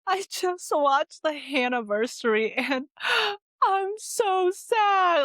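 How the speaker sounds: imitating her sobbing self, high with a gasp; slow, high, gasping mock sob; retold crying. Telling boyfriend Chris she is sad, imitated crying. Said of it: "bawling my eyes out to him".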